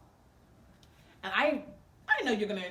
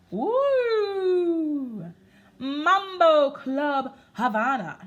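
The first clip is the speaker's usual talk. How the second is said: excited announcer; 6 semitones higher, 6 dB louder, much slower than usual. Acted.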